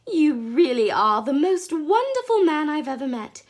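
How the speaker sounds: high, loud rapturous praise; rapturous outburst; delighted cry. A narrator's reading. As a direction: cry it out in adoration. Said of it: "and cried out".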